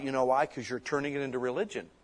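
normal talking voice

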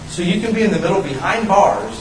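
ordinary talk, nothing marked

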